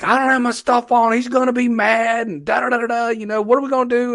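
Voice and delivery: high-pitched mock griping; fussing and complaining. Driver caught without his gear, hammed-up fussing and complaining. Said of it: "starts cussing and fussing and yelling and complaining".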